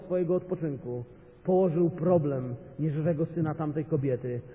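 usual speaking voice

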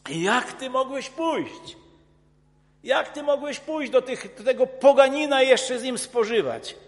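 mock the accusers' indignant challenge; accusing questions. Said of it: "spowiadano za to".

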